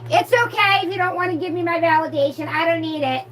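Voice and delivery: high-pitched feigned indifference; mock hurt. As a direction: play wounded in a high voice. Mock hurt at missing validation.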